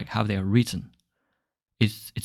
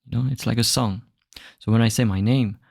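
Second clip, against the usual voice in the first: ordinary delivery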